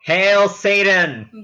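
slow, loud and high "Hail Satan!"; gleeful shout. Pretend. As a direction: belt the sign-off like a mock rally cry. Podcast sign-off call.

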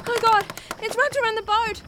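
high panicked cry; horrified and desperate; terrified. Acted. Creature wraps the boat.

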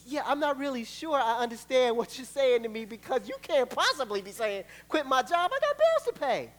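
rising to a high pitched protest at bills; incredulous push back, climbing up; whiny doubting believer. Acted. Believer protesting to God about the bills, rising whine.

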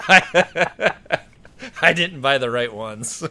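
talking through helpless laughter; real laughter breaking into the words; laughing. Real, not acted. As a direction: barely get the punchline out for laughing. Cracks up at own story's punchline.

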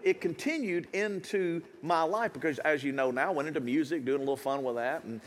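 usual speaking voice

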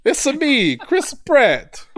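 very high, loud cartoon plumber voice; cartoon Italian plumber, high and bright; Mario voice. Mario impression, Chris Pratt.

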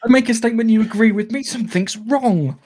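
high, laughing tease; laughing mock outrage. Real, not acted. Host teasing co-host for agreeing, laughing high.

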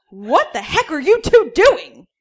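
high, loud, slow scolding yell; a shocked father bursting in on them; slow shocked shout from the hallway; outraged dad yell. Acted. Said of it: "came the voice from the hallway".

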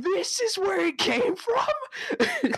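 mock shock. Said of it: "It's like".

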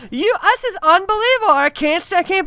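high, squeaky mock outrage; a shrill parody of the caller's anger; mock outraged girlfriend. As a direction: ham up the betrayed girlfriend. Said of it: "the girl goes".

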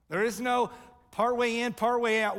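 loud, high, emphatic sermon call; rising preacher's cry; preaching at full force. Acted. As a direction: drive the point home from the pulpit. Preacher building to a peak.

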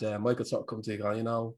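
ordinary delivery

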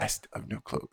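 whispered desperate protest. Retold or imitated. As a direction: hush it out, panicked.

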